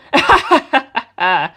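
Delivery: loud wild cackle. Acted. Mister cackling wildly at Doppio. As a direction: cackle like a lunatic. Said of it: "Mister cackled wildly".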